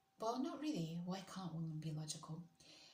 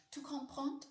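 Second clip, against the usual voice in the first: higher, firmer French accent attempt; retry it in an amateur French accent, pitched up; imperfect French accent; 7 semitones higher, 4 dB louder than usual. Shown as a demonstration. Streamer retrying the French after faulting the accent, higher imperfect French accent. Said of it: "I don't have a perfect French accent".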